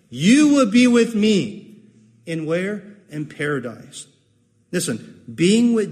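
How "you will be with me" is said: You said higher, louder and slow; stress on you. Shown as a demonstration. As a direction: punch You and with me. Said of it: "That should be the emphasis".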